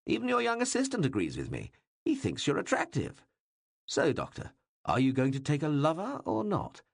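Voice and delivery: teasing king. Acted. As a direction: needle the shy assistant, grinning. King presses the doctor.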